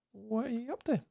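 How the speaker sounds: normal talking voice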